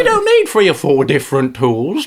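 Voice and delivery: usual speaking voice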